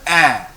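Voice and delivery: A as an open "eh"; French è sound. Shown as a demonstration. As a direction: say A like the vowel in "air". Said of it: "then we pronounce the E as".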